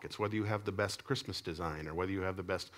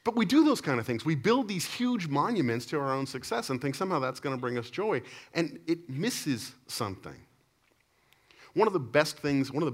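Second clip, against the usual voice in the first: higher, louder preaching push; 7 semitones higher, 5 dB louder than usual. Real, not acted. Pastor warning the congregation about pride, preaching higher and louder. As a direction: preach it to the room with force.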